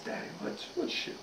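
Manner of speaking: put-on very soft little-boy question; timid kid voice, acted. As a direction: ask it small and hushed, like a puzzled child. Boy timidly asks father.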